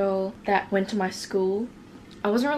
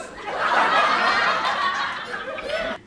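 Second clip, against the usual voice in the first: long, high wheezing laugh; real laughing fit. Real, not acted. Vlogger breaks down over the message she read, real wheezing laughter. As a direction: crack up until only wheeze is left. Cracks up at her old messages.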